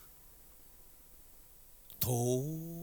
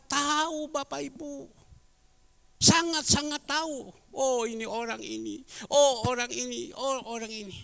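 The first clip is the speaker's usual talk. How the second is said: raised, loud preaching voice, higher pitched; 11 semitones higher, 6 dB louder than usual. Acted. Pastor exhorting the church, full-force preaching. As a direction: preach it hard to the congregation.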